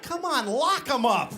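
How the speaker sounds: high, impatient mock pleading; hammed-up frustration with a helpless stranger; exasperated. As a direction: urge her up, exasperated. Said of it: "I'm like".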